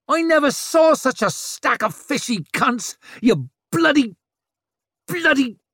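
high, loud furious tirade; furious yelling. Acted. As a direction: hurl abuse at them. Rikke hurling insults at retreating men.

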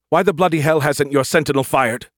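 high, loud, forceful challenge; angry demand. A narrator's reading. Commander demanding why the sentinel hasn't fired, angry. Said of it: "Brooke demanded".

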